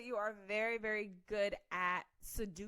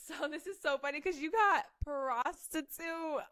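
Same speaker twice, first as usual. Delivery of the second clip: laughing; 6 semitones higher than usual. Real, not acted. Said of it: "I'm crying".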